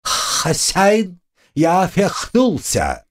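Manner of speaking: high, slow lisping squeak; lisping little creature. Acted. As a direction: squeak it out with a lisp. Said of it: "шепелявый голосок возродившегося таракана".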